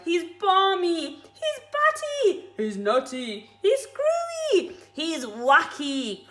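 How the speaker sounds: high, slow bird voice; the bird cries out that Mugglewump is mad; roly-poly bird crying. Acted. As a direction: squawk each word out. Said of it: "cried the roly-poly bird".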